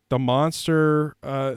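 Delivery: normal talking voice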